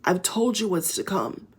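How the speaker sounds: ordinary delivery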